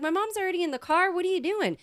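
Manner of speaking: fast, high-pitched acted objection; indignant, voice shooting up; exasperated protest. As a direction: rush it out in retold outrage. Retold quarrel over the bag.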